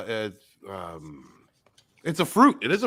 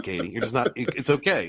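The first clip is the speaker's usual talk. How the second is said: ordinary delivery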